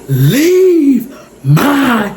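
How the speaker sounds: imitating a son's drawn-out, high-pitched command; lion-like command. Son orders a man out of mother's house, slow lion-like command. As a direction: stretch each word out slowly and forcefully. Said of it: "sound like ... a grown man".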